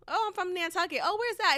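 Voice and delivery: high-pitched imitation of casual chat; mock small talk. Explaining hometown to someone from Houston, mock small talk. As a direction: act out both sides, pitched well up.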